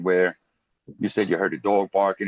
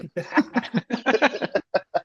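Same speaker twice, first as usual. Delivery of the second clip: high laugh; 13 semitones higher than usual. Real, not acted. Loud laugh after quote.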